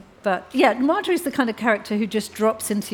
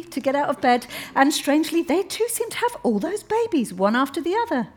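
put-on higher, sweetly pointed young woman at the dinner table; a higher, overly polite voice that needles the men; Alice, polite but pointed; 7 semitones higher than usual. Alice argues back at dinner.

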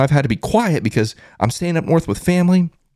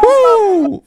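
very high, loud "woo"; piercingly high fan cheer; celebratory whoop; 14 semitones higher, 7 dB louder than usual. Real, not acted. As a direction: let out a big victory whoop. Celebrating as guest leaves.

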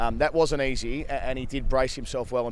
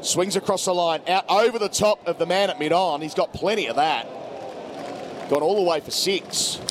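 cricket commentator; 4 semitones higher than usual. Real, not acted. Live call building to wicket.

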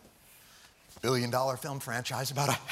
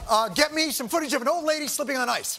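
high, loud frantic orders; panicked rush; 12 semitones higher, 7 dB louder than usual. Acted. Ordering staff before the show, high loud panic. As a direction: scramble like showtime is now.

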